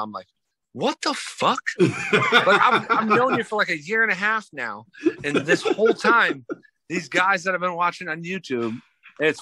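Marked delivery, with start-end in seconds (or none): 0.7-6.4 laughing disbelief. Retold or imitated. Retold surprise, laughing, high.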